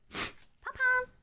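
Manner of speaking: high sing-song dog call. Real, not acted. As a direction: coo the dog's name up high. Calling the dog sweetly.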